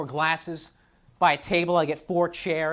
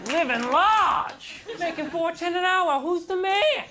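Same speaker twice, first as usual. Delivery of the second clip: higher, laughing boast; mock bragging loser; 7 semitones higher, much slower than usual. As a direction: gloat like it's something to be proud of. Loser's real slogan shirts.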